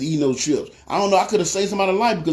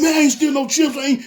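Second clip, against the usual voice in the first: high, indignant mock protest; defensive, getting loud; 10 semitones higher, 3 dB louder than usual. Accused man denies stealing chips, high and defensive. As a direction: protest your innocence, voice pitched way up. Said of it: "he started getting defensive, getting loud".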